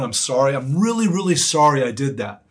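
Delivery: acted earnest apology, pitch jumping on the first "really"; emphatic apology. Preacher acting out telling someone sorry, emphatic. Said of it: "So the equivalent for us would be".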